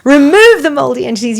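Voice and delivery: loud, high command casting something out; forceful retold prayer. Woman in ministry casting out mold, loud forceful command.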